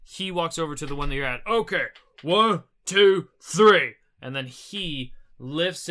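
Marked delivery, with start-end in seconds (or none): 1.5-4.0 loud, high, slow count; lifting count. Acted. Guy counts before lifting the load, effortful loud count. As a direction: heave on each number.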